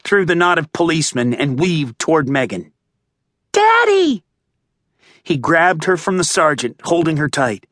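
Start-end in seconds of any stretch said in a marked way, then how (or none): 3.5-4.2 put-on very high child's cry of relief; small daughter calling her daddy; little girl. A narrator's reading. Little girl calls to father.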